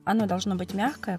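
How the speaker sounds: normal talking voice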